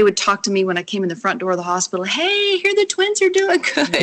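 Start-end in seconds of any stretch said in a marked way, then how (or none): 2.0-4.0 very high, sing-out cheery staff voice; chirpy mock greeting. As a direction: imitate overjoyed hospital staff calling hello. Hospital staff cheerfully greeting her.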